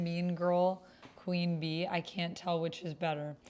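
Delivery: ordinary talk, nothing marked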